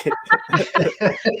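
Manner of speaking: high amused laugh; high laughter. Real, not acted. Host laughing at the youngins comment, laughing. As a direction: break into laughter.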